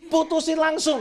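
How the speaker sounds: repeating the crowd's "break up now" loudly; called-out answer. Retold or imitated. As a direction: shout the verdict back. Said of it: "Yang ada di sini bilang".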